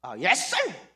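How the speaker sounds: quick bright yes sir, jumping up on sir; eager barber agreeing. Retold or imitated. Barber eagerly agreeing with the foreign customer. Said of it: "확 그러자는 거야".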